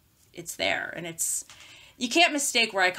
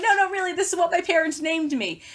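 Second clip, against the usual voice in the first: emphatic protest; 9 semitones higher than usual. Retold or imitated. Insisting to a disbelieving local.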